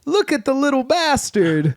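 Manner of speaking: high mock cooing insult; misguided fond greeting. Marathon guy seeing a baby, cooing mock insult. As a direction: coo the insult high, as if it were polite. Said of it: "thinks that's how you talk to people".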